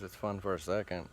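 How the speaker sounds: ordinary talk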